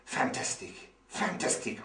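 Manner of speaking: hushed, breathless wonder at the student's own speaking; amazed student. Pretend. Teacher voicing the amazed learner, hushed awe. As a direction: whisper it as if you can't believe your ears. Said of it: "And you will say".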